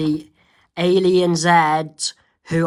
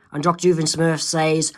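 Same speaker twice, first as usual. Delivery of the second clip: plain reading voice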